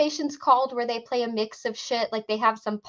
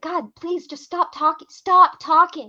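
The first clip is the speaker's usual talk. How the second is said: drawn-out mock begging, building louder and higher; retold exasperation, rising at the end; exasperated plea; 5 semitones higher, 5 dB louder, slower than usual. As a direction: plead slowly, as if you can't bear another word. Retold plea to stop talking.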